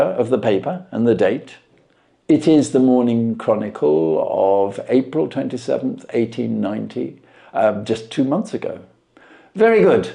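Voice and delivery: measured, knowing detective voice; Holmes voiced. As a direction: lay out the deductions with calm assurance.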